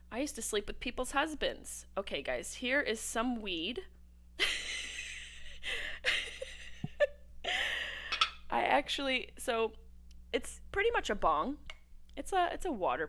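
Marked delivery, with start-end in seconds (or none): 4.4-8.4 breathless squealing laugh; high silent squeal laugh. Real, not acted. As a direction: crack up high and airless. Laughing at own joke.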